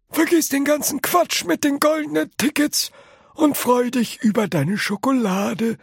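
put-on high old man, brushing it off; old grandpa voice. A narrator's reading. Grandpa Joe tells Charlie to forget the tickets, high old voice. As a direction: wave it away in a high grandpa voice.

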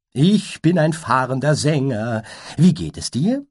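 a flitting wandering minstrel butterfly, chirpy; high butterfly voice. Acted. Butterfly greets the unicorn in a high, light voice.

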